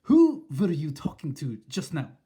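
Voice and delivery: loud, sharp witch demand; snapped. Acted. Witch interrogating the grandmother, loud and sharp. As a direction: snap the question. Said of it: "snapped the Grand High Witch".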